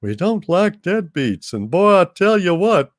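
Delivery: lightly voiced Texan Hal scolding a deadbeat; Texan propane salesman. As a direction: lecture Dave like a salesman.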